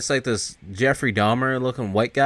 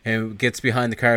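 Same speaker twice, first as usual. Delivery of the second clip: usual speaking voice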